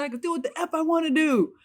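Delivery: high, fast defiant yell; mock shout. Husband screaming defiance at wife, high fast yell. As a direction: fire it off at her. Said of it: "why are you screaming at me".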